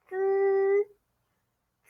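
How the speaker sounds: "Z" as a buzzing syllable held high and level; first tone. Shown as a demonstration. Teacher turning the Z sound into first tone, held high and level "Z". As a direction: stretch "Z" on one steady high note. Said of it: "say it with first tone".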